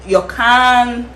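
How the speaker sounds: ordinary talk, nothing marked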